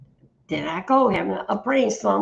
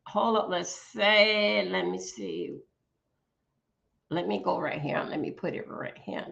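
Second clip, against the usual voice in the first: soft mumble, half under the breath; low private mutter; muttering to self; 4 semitones lower, 6 dB quieter than usual. Real, not acted. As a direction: talk quietly to yourself while searching. Muttering to self while searching.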